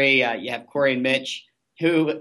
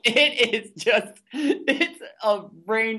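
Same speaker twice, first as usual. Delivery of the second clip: laughing; 7 semitones higher, slower than usual. Real, not acted. Laughing about vomit rain.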